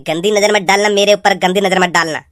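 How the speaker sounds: put-on sarcastic echo of the girl's warning; mocking her words. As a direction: parrot her line back to ridicule it. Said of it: "कि".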